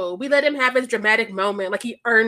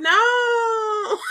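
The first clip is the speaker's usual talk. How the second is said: high, laughing cry of protest; mock outraged no; 8 semitones higher than usual. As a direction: wail it like your team lost. Conductor saves hated character.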